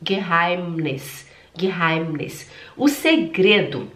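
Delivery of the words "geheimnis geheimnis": Geheimnis low, with a humming M; slow low model of Geheimnis, M lengthened; closed-lip M. Shown as a demonstration. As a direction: close the lips firmly on the M of Geheimnis. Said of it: "só faz fechar os lábios que o som sai perfeito".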